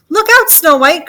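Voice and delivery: high, loud alarm call; shouted warning. Acted. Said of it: "Gertie shouted".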